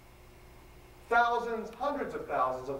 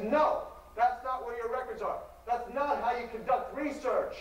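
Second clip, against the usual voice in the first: higher, prim librarian correcting a visitor; lecturing librarian; 9 semitones higher than usual. Retold or imitated.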